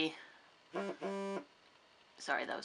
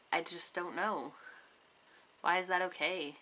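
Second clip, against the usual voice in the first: ordinary talk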